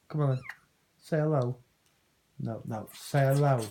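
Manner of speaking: ordinary talk, nothing marked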